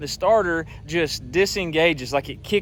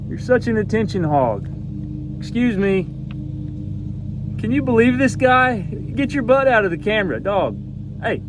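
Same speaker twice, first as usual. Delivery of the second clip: playful scolding; 5 semitones higher than usual. Real, not acted. Scolding dog blocking camera.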